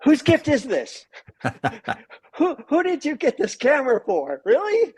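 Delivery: high, teasing disbelief; high mocking voice, quizzing about the camera; mock incredulous. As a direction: play the baffled wife, pitch way up. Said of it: "I'm like".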